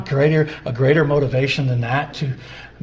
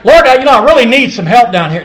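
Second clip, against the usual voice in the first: loud, brisk, higher-pitched mock prayer; offhand prayer; 8 semitones higher, 11 dB louder, faster than usual. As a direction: rattle off a casual request to God, loudly. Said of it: "It's not like".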